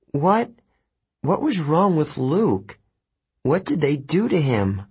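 high-pitched, distressed questions; upset questioning. A narrator's reading. Annabeth, red-eyed from crying, asks about Luke, upset.

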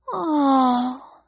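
soft breathed oh, on a held high tone. A narrator's reading. Ellie seeing the hurt collie, a soft breathed oh. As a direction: let the oh out on a breath. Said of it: "Ellie breathed".

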